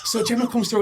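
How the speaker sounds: ordinary delivery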